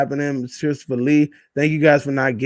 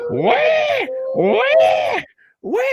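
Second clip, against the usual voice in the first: imitated wailing; much slower than usual. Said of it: "you just hear".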